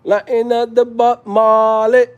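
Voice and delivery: high, drawn-out Jamaican patois; mock Jamaican accent. Vlogger picturing a trip to Jamaica, mock Jamaican accent. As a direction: stretch it out in playful Jamaican. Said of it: "if I go to Jamaica".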